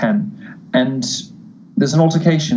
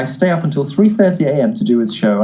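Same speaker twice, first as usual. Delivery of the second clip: usual speaking voice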